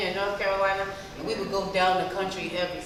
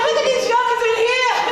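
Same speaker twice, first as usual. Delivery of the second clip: high loud playful exclaim; excited exclaim; 13 semitones higher, 9 dB louder than usual. Retold or imitated. Mock reaction to young filmmakers.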